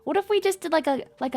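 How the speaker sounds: usual speaking voice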